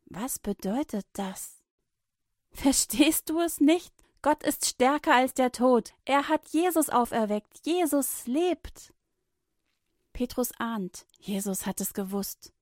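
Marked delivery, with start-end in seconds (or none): 2.5-8.9 high and loud, slowing at the end; joyful proclaiming. A narrator's reading. Women proclaiming Jesus lives, joyful. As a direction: announce it with joy.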